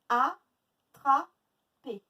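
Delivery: ATTRAPER very slow, loud a, tra, per; three beats of Attraper with long gaps; split into syllables. Shown as a demonstration. Teacher counting three syllables, syllable by syllable. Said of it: "Trois syllabes".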